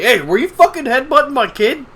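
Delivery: high loud angry demand; angry dad. Pretend. Pissed-off dad confronts teacher about his kid, angry. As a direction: confront him like a furious father.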